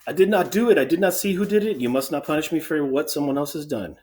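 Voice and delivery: high, loud, urgent denial; protesting innocence. Acted. Sentry begs the king not to punish him, protesting.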